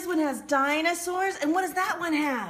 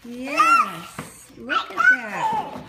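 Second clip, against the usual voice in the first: excited; 11 semitones higher, 5 dB louder, much slower than usual. Real, not acted. Parent excited over gift map.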